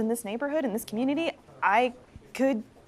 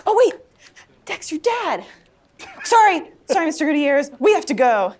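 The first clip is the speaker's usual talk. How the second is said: higher, louder, urgent apology; sudden alarm; 6 semitones higher, 7 dB louder than usual. Acted. Girl remembers friend's dad and excuses herself, suddenly urgent. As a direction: break off and hurry out loudly.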